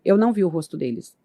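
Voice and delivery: ordinary delivery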